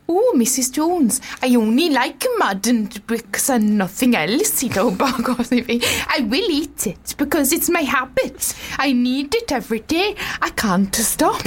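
slow, hammed-up Welsh lilt; Welsh accent. Shown as a demonstration. Playing the brick addict confessing to Missus Jones in hammed-up Welsh. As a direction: stretch it out in exaggerated Welsh. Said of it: "let's try it in a Welsh accent".

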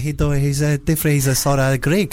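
put-on a lightly done, jokey South African accent. To co-host, a weak jokey South African accent. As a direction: ham up a South African accent, only faintly shifted. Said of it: "you know my South African accent".

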